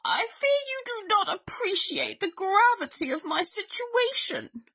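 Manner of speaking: high, pompous invalid bear; aggrieved bear. A narrator's reading. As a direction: complain with wounded dignity. Ailing Bear scolds Mouse.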